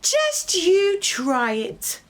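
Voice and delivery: high, slow cheeky challenge. Acted. Third little pig cheekily daring the wolf to try. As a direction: tease the wolf, daring him. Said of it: "called the third little cheeky pig".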